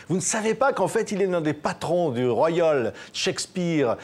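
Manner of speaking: ordinary talk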